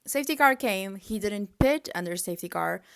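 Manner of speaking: usual speaking voice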